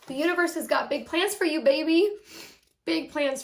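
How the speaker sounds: high, loud, bursting with excitement; excited. Real, not acted. Reader hyping up Aquarius about big plans. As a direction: cheer them on with big energy.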